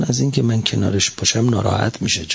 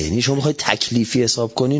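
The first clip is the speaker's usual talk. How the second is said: ordinary talk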